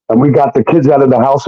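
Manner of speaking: ordinary talk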